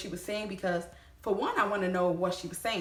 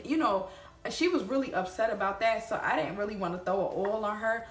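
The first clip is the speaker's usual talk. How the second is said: ordinary talk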